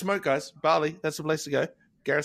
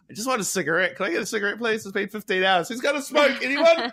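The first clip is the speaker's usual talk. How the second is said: desperate plea; 7 semitones higher than usual. Retold or imitated. Exhausted traveller begging strangers for a cigarette, mock desperate. Desperate smoker after long flight.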